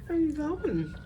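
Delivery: pet talk. Real, not acted. Calling after dog Joe.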